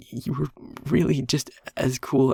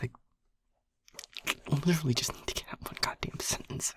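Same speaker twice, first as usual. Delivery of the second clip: soft, breaking-off upset murmur; halting, whispery frustration with yourself; 3 semitones higher, 6 dB quieter than usual. Acted. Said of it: "I'm getting upset".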